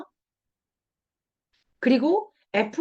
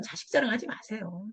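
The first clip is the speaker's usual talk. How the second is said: usual speaking voice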